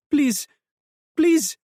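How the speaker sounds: high, broken, near-whispered begging; desperate broken plea. A narrator's reading. Hiding woman begging strangers for mercy, desperate plea. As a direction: plead like a terrified woman in hiding.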